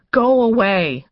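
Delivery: loud, high, firm dismissal; retorting. A narrator's reading. Woman to visitor behind locked door, loud retort. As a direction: send them off forcefully. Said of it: "she retorted".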